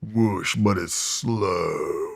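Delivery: slow, soft, muffled underwater sound; slow muffled whoosh. Retold or imitated. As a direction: drag it out like hearing underwater. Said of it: "sounds like you're underwater".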